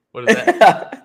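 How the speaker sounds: high, loud laughing question; slow, delighted laughing disbelief. Real, not acted. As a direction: burst out laughing as you ask.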